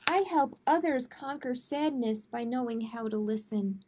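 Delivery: gentle, measured picture-book read-aloud; reading to children. A narrator's reading. Librarian reading a kindness book to kids, gentle read-aloud.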